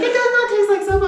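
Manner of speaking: high, loud insistent mom; mom insisting. Retold or imitated. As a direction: firmly contradict the kid. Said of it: "She's like".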